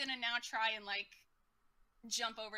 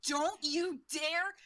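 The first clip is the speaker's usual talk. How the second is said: fierce warning; 4 semitones higher, 5 dB louder, much slower than usual. Acted. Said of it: "She's like".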